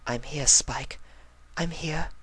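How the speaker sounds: softly voiced Rarity reassuring. Rarity reassuring Spike in her mind, softly. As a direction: gently answer into the dark.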